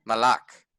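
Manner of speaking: malak set apart, with ah vowels; pronounced with ah. Shown as a demonstration. Teacher sounding out the word for the class, malak with ah. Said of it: "So this word is".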